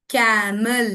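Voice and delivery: "कैमल" drawn out as ka-muhl; schwa in second syllable. Shown as a demonstration. Teacher showing an example word, "कैमल" with schwa. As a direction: sound out "कैमल", second vowel a clear uh. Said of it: "E is giving a sound".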